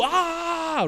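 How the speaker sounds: eager high held pretend scream; long, high scream at restrained volume; mock scream. Sailor proves he can do the line, mock scream. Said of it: "And you're like".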